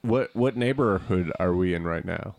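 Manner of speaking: ordinary delivery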